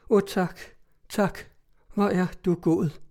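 a light burst of gratitude; relieved exclamation. A narrator's reading. The cousin thanks him for the promise, mildly relieved. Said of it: "hun udbrød".

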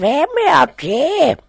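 high, loud, slow frail granny; old grandmother voice. Acted. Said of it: "spurgte hun".

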